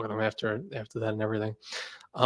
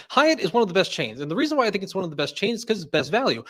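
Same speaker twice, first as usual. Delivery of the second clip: higher, rapid-fire expert pitch; JP Knowledge impression; 6 semitones higher than usual. Streamer imitates JP Knowledge rattling off hotel facts, high and fast. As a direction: rattle off facts without pause. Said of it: "He's like".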